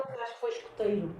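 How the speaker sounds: usual speaking voice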